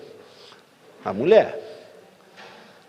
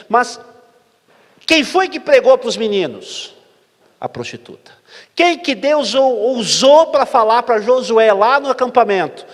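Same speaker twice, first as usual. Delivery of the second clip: loud, high, slowed-down preaching; preacher raising his voice to the crowd; preaching at full force; 8 semitones higher, 7 dB louder, slower than usual. Acted. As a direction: hammer each question home to the congregation. Preacher builds to a climax.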